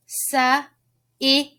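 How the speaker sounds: "S, I, P" as suh, ih, puh; sounded out. Shown as a demonstration. Teacher sounding out a word, "S, I, P" as suh, ih, puh. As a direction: give "S, I, P" as separate letter sounds with pauses. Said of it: "What letter makes that P sound?".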